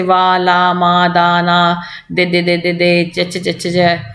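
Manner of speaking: put-on low, slow nonsense syllables like a baby; babbling baby sounds. Shown as a demonstration. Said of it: "बच्चा जो शुरू में उच्चारण करता है".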